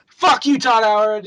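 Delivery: high, loud pretend tirade; mock abuse. Podcaster pretending to slag off the developer, loud mock anger. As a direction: launch into a playful insult. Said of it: "slag off Todd Howard a bunch".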